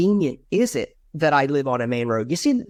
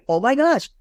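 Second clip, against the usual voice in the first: excited; 8 semitones higher, 3 dB louder than usual. Acted. Acted delight meeting fellow Virginian.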